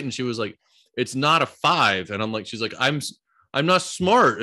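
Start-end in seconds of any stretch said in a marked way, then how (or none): 1.0-2.1 slow, higher, louder imitation of a hurt child; upset daughter. Upset daughter voiced in story. 2.8-4.4 slow, higher, louder imitation of a hurt child; upset daughter. Upset daughter voiced in story.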